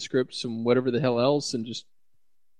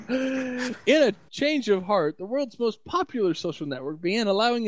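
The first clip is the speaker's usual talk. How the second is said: mock dramatic news read; 8 semitones higher than usual. Shown as a demonstration. Reading headline slowly, high.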